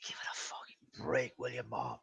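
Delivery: very soft, whispered aside; hushed retort. Retold or imitated. Son to mom after the prank, hushed retort. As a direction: drop right down into a low whisper. Son's muttered retort to mother.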